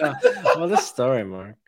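slow, laughing through the line; laughing quote. Retold or imitated. Cohost echoing the film line, laughing. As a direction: giggle out the famous quote.

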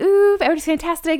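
high, fast gushing party chatter; mock gushing. Host mimicking gushing guests at the party, high fast gush.

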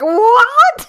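high squeaky disbelief; shocked what. Retold or imitated. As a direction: gasp out a stunned what. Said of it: "people that I know listening are like".

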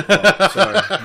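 a sudden high, loud laughing "sorry"; laughing apology. Real, not acted. Teased about his accent, he laughs out a loud apology. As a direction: burst out laughing as you apologise.

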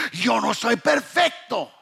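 ordinary talk, nothing marked

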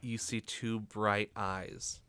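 hushed, slow game master narration; suspenseful scene setting. Acted. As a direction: let the creature creep in, softly, with long pauses.

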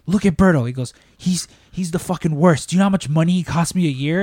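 hammed-up angry boss yelling about costs; mock furious boss. Boss yelling at Rick about Berto costing money, mock furious.

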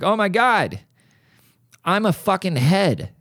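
high-pitched dismayed exclamation; hammed-up waking shock; mock horror. Imagines waking as a severed head.

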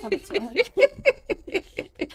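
laughing through the words; words squeezed out mid-laugh. Real, not acted. Joking about spending Christmas at work.

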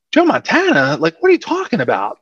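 high-pitched retold disbelief; incredulous. Team scoffing at getting Joe Montana.

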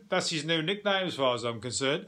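ordinary talk, nothing marked

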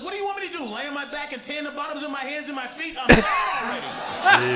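hammed-up exasperation at being stuck; exasperated protest. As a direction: whine it out, slowing on the last words. Imagined protest at a beach day.

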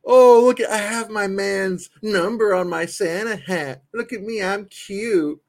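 high, slow mock girly voice; mocking fans. Host mocks the women fans in a high prissy voice. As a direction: sneer at them in a high prissy voice. Mocking cute fans' selfies.

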